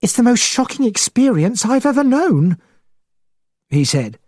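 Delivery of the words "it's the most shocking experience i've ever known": high-pitched excited gentleman; agitated, voice pitched well up. A narrator's reading. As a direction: blurt out the shock in a high voice. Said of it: "He spoke in an excited, high voice".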